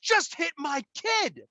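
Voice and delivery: angry parent in the dollar store; retold yell. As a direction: spit out every word at her. Yelling at daughter in store.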